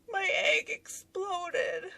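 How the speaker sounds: high, slow, tearful whimper, imitated; mock crying. Wife explaining to husband at her desk, mock-tearful whine. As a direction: wail it slowly in a high hammed-up cry. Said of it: "crying at my desk".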